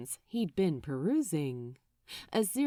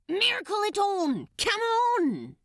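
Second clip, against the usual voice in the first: much higher, louder, exasperated; exasperated Crowley; 11 semitones higher, 4 dB louder than usual. Acted. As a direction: yell at him to just use a miracle. Crowley urging angel.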